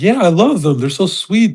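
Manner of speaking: mock a sweet streamer girl; gushing imitation.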